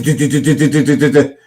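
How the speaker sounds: fast du-du patter mimicking speech; imitating rapid Glaswegian chatter. Shown as a demonstration. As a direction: rattle off syllables like fast talk. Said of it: "the faster they get".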